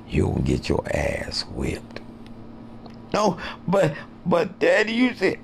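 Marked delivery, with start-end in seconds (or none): 3.1-5.4 put-on high pleading kid voice; whiny child protest. Son protests to his father, high and whiny. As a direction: beg like a little boy caught out.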